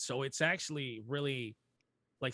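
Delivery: ordinary talk, nothing marked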